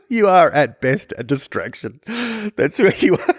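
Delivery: teasing through laughter; laughing. Real, not acted. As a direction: laugh while teasing a friend.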